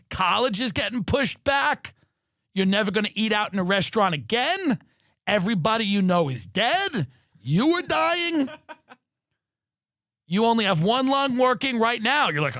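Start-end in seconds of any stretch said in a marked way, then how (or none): 5.3-12.3 mock doom. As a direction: stretch it out like a grim verdict. Doom-monger act on the phone.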